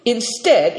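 ordinary delivery

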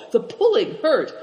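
plain reading voice

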